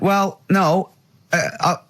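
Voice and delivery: lightly voiced sulky Carl; imitating Carl. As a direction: mimic a huffy friend. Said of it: "And Carl went".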